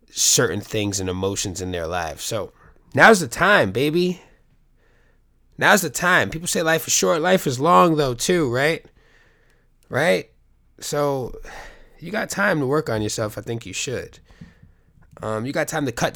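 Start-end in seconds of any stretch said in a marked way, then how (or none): 2.9-13.4 much higher and louder, preaching to the viewers; rally them, full energy; urging viewers to work on themselves, fired up; fired-up pep talk. Real, not acted.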